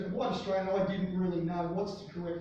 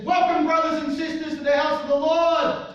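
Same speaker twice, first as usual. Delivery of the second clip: preacher's booming welcome; 9 semitones higher, 12 dB louder than usual. Retold or imitated. Reverend greets the congregation.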